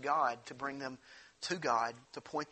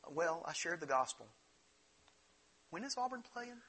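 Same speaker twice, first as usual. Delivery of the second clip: flippant self; 5 dB quieter than usual. Retold or imitated. Mock self quote, flippant.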